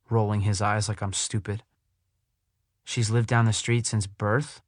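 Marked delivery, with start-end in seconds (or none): 2.8-4.6 "since birth?" rising at the end as if asking; said like a question. Acted. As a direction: turn the statement into a question on "birth". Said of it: "He says it like a question".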